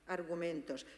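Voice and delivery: ordinary talk, nothing marked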